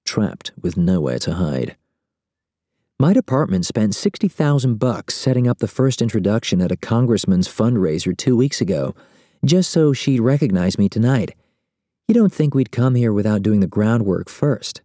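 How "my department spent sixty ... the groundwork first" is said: a hint of a higher, brisk woman's voice; narrator's light woman voice, matter of fact; lightly voiced Ramona, cool and knowing. A narrator's reading. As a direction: explain it like an old hand, a touch impatient.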